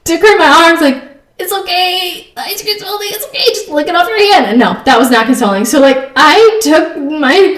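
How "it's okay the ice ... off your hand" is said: comforting. Retold or imitated. Mom consoles crying daughter in her arms, comforting. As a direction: soothe a small child in a high little voice. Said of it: "I was comforting but laughing".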